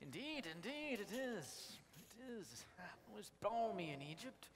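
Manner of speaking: lightly put-on posh English accent. Joseph greeting his brothers in a mild posh English accent. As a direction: play the grand Egyptian host in a posh English accent. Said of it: "This accent is wearing on me".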